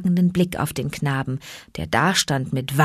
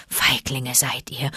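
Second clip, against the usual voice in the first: whisper; 5 semitones lower, 6 dB quieter than usual. A narrator's reading. Akela scorns the pack.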